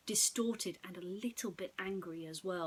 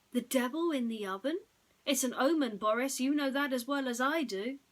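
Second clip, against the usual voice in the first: louder, higher wife's voice, insistent; 5 semitones higher, 6 dB louder than usual. Acted. Wife tells Boris the devil is an omen, louder and insistent.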